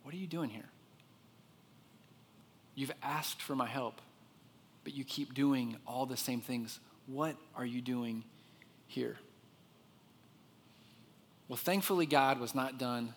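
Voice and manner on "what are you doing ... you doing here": very soft, measured reproof; hushed, patient confronting; God's voice. Acted. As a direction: question gently with long pauses. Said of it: "I bet you can hear his voice".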